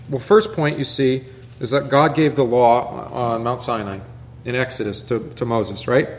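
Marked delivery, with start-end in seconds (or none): none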